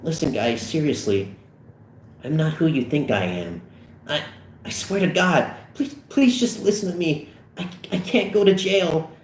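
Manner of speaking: halting plea climbing high; mounting nervous desperation; terrified pleading. A narrator's reading. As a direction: beg for mercy, stumbling over words. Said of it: "Terrified beyond belief".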